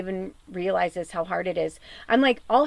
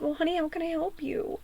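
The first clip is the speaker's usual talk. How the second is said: higher, soft voice to a hurting pet; tender coaxing; 6 semitones higher, 8 dB quieter than usual. Retold or imitated. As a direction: coax gently, as if to an ailing old cat. Coaxing her hurting old cat.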